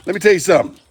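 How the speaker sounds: ordinary talk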